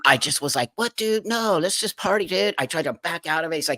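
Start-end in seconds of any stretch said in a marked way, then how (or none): 0.8-2.5 high-pitched acted protest; nervous deflection. Stalling the threatening man, nervously casual. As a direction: try to talk him round, pitched high.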